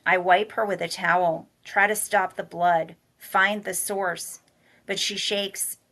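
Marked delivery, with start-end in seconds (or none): none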